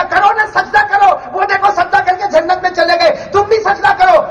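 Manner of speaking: high-pitched insistent order; commanding preaching. Acted. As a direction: press the command as God's call. Preacher voices God commanding prostration. (اونچے سُر میں تاکیدی حکم)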